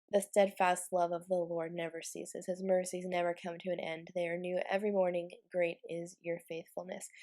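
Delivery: usual speaking voice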